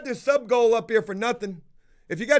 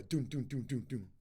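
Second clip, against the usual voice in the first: soft low thudding footstep sounds; quiet doom doom footstep imitation; imitated footsteps; 8 semitones lower, 11 dB quieter than usual. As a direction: mimic heavy steps through a wall, quietly. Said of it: "dude walking back and forth".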